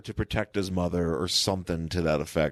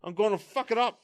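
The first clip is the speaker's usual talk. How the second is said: high, strained put-on monster voice; Hulk-style threat. Hulk vowing destruction, high mock threat. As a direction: repeat the vow in a pitched-up mock roar. Repeats Hulk's acted threat.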